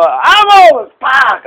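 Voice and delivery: loud high shout of a name; shouting a friend's name while slapping him; calling out. Retold or imitated. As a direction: call out the friend's name as you strike.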